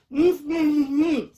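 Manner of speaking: high, loud muffled humming through a gag; gagged muffled hums. A narrator's reading. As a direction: hum urgently as if gagged by a sock. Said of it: "Don't speak with your mouth full".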